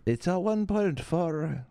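hammed-up Chinese accent, slow and high; slow, high mock Chinese accent. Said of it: "It's a new accent".

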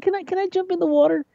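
high granny voice in awe and mild fright; old grandmother voice. Retold or imitated. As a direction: squeak it out like an amazed granny afraid to fall. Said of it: "She's like".

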